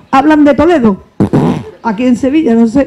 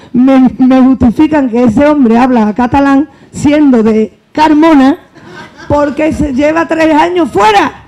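slow, each word spelled out in disbelief; slow indignant telling; slower than usual. Real, not acted. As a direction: drag it out, incredulous. Outraged retelling, slowed.

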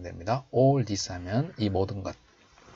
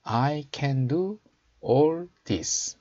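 slow, raised teaching voice; slow model reading; 5 semitones higher, 3 dB louder, much slower than usual. Shown as a demonstration. Teacher showing learners the English verse, slow model reading. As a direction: read it out slowly for learners to copy.

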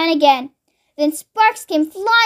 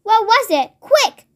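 high, eager question jumping higher at the end; urgent demand; 6 semitones higher than usual. Acted. Mister Cranky demanding what George left out, urgent. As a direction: demand the answer impatiently, lightly acted. Father demands answer in haste.

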